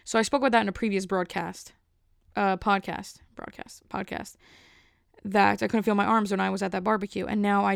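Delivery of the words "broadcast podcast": whispered under the breath; a quick whispered self-correction. Real, not acted. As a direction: whisper the slip to yourself. Muttered self-correction of a word.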